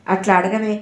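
usual speaking voice

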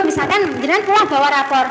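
normal talking voice

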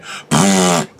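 wet lip-trill raspberry; sputtering raspberry noise; blown raspberry. Shown as a demonstration. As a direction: blow a raspberry with the lips. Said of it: "I'll give you a raspberry".

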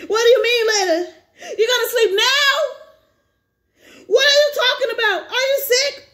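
high, loud, half-laughing panic; panicked pleading. Acted. Grandson panicking as grandpa says goodbye, high and loud. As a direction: plead in alarm, voice breaking into laughter.

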